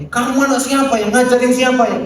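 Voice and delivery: high, indignant scolding question; mock angry parent. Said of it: "Kan nggak pernah tanya".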